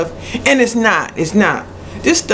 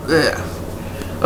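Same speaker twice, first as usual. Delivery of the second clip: disgusted groan; 3 semitones lower, 8 dB quieter than usual. Real, not acted. Disgust after tasting the syrup.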